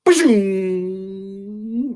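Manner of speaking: long whooshing getaway sound; drawn-out imitated zoom; zoom noise. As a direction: whoosh like something shooting away. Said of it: "that squirrel went".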